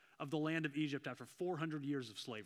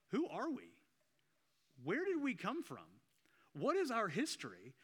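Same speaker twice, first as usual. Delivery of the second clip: higher, lost and searching questions; bewildered voice of the people; 6 semitones higher than usual. Retold or imitated. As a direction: voice the freed slaves asking who they are. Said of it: "where as they left Egypt of like".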